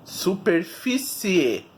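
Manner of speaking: "superfície" very slowly, final ci-e sounded out; slow, clearly split ending on "superfície"; ending shown as i-e. Shown as a demonstration.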